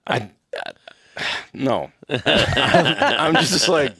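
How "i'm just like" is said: laughing through the words; can barely talk for laughing. Real, not acted. Laughing while telling.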